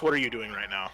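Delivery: ordinary delivery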